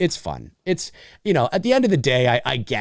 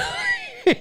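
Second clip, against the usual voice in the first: big laugh. Real, not acted. Laughing at the stereotype.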